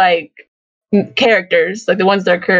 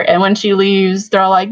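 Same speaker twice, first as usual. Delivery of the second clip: ordinary delivery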